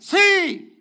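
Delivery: shouted high and loud; exclaimed. Real, not acted. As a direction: burst out with it like a command. Preacher exclaiming the word's meaning.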